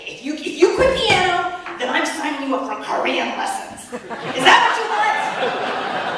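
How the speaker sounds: loud, high threatening mom with a laugh; hammed-up parental threat; half-laughing angry yell at a kid; mock yelling back. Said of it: "on the verge of yelling back at him".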